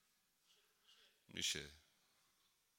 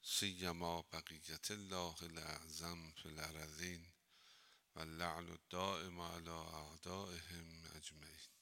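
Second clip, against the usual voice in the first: slow, softer devotional recitation; formal Arabic invocation; 5 semitones lower, 6 dB quieter, slower than usual. Acted. Cleric opening the lesson with a slow invocation. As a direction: intone the opening blessing slowly.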